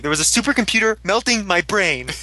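a high, hurried voice for a panicky friend; lightly voiced nervous guy insisting; frantic explaining. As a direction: play him scrambling to explain himself.

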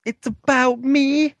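high, slow, dramatic reading; a grand, slowed-down declaration up high; dramatic hero line. Retold or imitated. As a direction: stretch it out like a big hero moment.

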